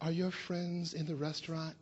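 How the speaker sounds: the hostile clerk turned mild and polite; suddenly sweet tone. Retold or imitated. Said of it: "totally different tone of voice".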